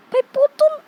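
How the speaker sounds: loud, high startled cry; startled exclaim. Real, not acted. Gamer spotting the doll in the box, loud startled cry. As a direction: blurt it out shocked.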